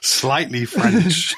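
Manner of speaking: slow, drawn-out echo, savouring each word. Real, not acted. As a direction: stretch it out, amused. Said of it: "slightly French in his lifestyle".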